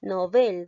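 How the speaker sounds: "Novel" with the last syllable hit, no-BEL; stress on BEL. Shown as a demonstration. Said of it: "te vas a basar en mi pronunciación". ("Novel" con fuerza de voz en BEL)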